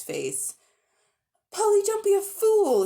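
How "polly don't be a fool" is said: loud high alarmed shout. Acted. Diggory warning Polly off the rings, loud shout. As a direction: yell to stop her in time. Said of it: "he shouted".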